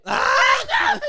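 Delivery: high shriek into laughter; startled shriek. Real, not acted. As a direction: shriek then laugh helplessly.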